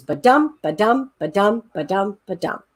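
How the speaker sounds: five ba-dums, each stressing dum; iambic beat. Shown as a demonstration. Teacher tapping out iambic pentameter, chanted. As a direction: chant the meter steadily. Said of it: "It goes".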